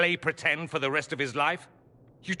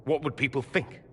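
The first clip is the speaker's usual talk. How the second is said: normal talking voice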